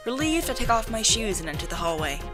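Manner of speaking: usual speaking voice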